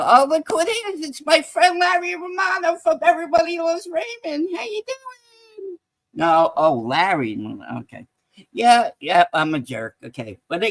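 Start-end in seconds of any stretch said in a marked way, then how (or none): 0.0-5.8 high, delighted hello to a friend; stretched, high How you doing?; excited greeting. Real, not acted. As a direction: sing out a big welcome. Greets Larry Romano in chat.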